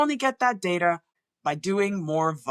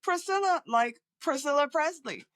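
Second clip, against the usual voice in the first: higher, chirpy voice of a patient recognizing the name; imitated patient, pitched well up; mimicking a patient; 7 semitones higher than usual. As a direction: play the delighted patient making the famous-name link. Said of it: "So the most common one is".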